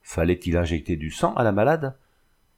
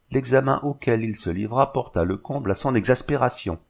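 ordinary delivery, nothing marked

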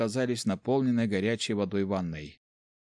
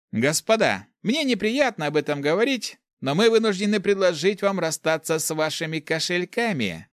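high, raised voice with a smile; smiling quote; 8 semitones higher, 4 dB louder than usual. A narrator's reading. Thief demands the opponents' purses, quoting with a smile. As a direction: quote it back smilingly, pitched up. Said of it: "с улыбкой процитировал воришка воина".

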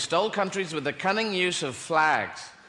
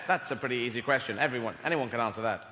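ordinary talk, nothing marked

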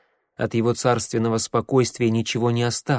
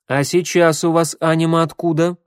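raised, louder pressing question; insistent, higher-pitched challenge; 6 semitones higher, 5 dB louder than usual. A narrator's reading. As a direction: push hard for an answer. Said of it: "напирала Гвен".

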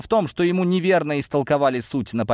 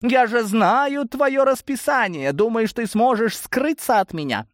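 high, slow, drawn-out taunt; taunting huntress; 12 semitones higher than usual. A narrator's reading. Blonde to the captured Osokin, taunting. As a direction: toy with cornered prey in a high voice.

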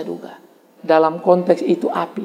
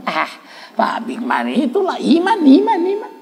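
mock admirer; 7 semitones higher, slower than usual. Mocking people praising a preacher.

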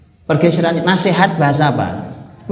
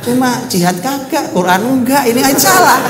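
scolding jab; 9 semitones higher, 5 dB louder than usual. Acted. Scolding lazy critics.

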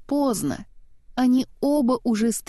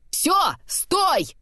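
high, raised stop cry; mock yell; 10 semitones higher than usual. A narrator's reading. Kristoff at the cliff edge, high yell. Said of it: "заорал Кристоф".

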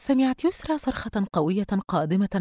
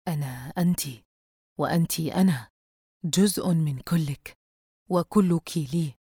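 menacing dark figure; slower than usual. A narrator's reading. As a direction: speak like a demon claiming her.

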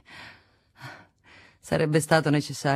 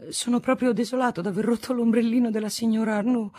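flustered apology; 7 semitones higher than usual. A narrator's reading. Apologizing after breaking parasol.

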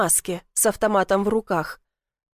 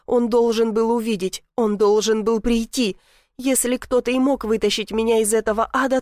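plain reading voice

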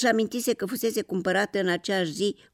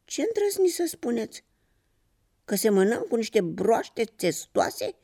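higher-pitched affronted outburst; indignant cry; 6 semitones higher than usual. A narrator's reading. New slippers answering the toad insult, indignant cry. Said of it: "strigară în cor cei doi pantofi".

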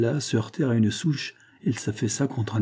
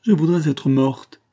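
despairing cry; 5 semitones higher, 4 dB louder, slower than usual. A narrator's reading. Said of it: "s'écria-t-elle".

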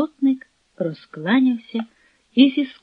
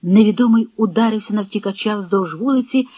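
ordinary delivery, nothing marked

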